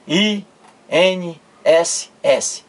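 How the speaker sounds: I N S S slowly, a gap between letter names; spelled letter by letter. Shown as a demonstration. Teacher showing each letter pronounced, slow spelling. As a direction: spell out I, N, S, S one by one. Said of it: "eu pronuncio cada letra. Eu digo".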